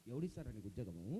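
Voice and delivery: very low, soft scornful refusal; low dismissive mutter. Acted. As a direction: brush him off under your breath. Host turns down the job request.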